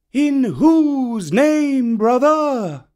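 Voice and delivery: high, slow, calling bird voice; kite voice. A narrator's reading. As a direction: call down from far above.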